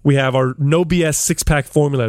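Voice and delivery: ordinary talk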